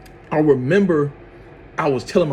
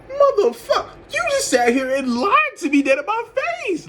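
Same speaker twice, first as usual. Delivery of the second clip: mock outrage. Outraged at her lie, retold.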